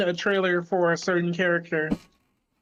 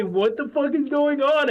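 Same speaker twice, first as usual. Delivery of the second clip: raised, higher-pitched mock bewilderment; impression of friend; 9 semitones higher, 3 dB louder than usual. Streamer mocking friends' reaction to the death battle, exasperated impression. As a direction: imitate a clueless friend's baffled outburst. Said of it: "that was a great impression of me".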